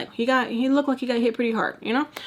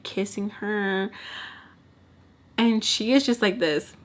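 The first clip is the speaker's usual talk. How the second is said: usual speaking voice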